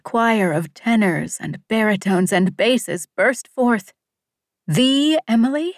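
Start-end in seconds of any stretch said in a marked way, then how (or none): 4.7-5.7 surprised. Acted. Choir of voices surprised.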